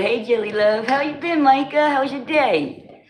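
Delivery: lightly mimicking a viewer's sweet greeting; imitating the chatter. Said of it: "He said".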